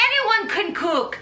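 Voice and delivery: put-on a loose French chef accent on "Anyone can cook"; half-hearted French chef accent; mock French chef. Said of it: "Gustave is this famous French chef".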